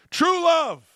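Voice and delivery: high, loud swooning cry of true love; mock rapturous exclaim. Host hamming up a swoon over the coach's wedding. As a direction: belt it out like a lovestruck fool.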